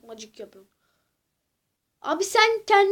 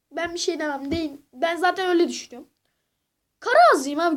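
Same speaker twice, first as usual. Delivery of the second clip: normal talking voice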